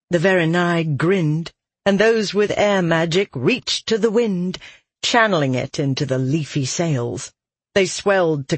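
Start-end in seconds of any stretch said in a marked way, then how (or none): none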